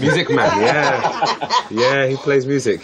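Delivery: chuckling while speaking; laughing through the words. Real, not acted. Amused at father's nickname.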